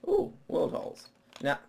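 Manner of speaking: normal talking voice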